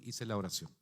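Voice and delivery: normal talking voice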